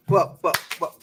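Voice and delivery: talking while eating chips; words round a mouthful of chips; mouth full. Real, not acted. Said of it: "I'm eating chips".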